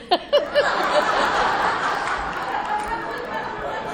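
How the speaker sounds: high, breathy laugh. Real, not acted. Joking to the group, high breathy laugh. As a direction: let the laugh out. Laughing after all good.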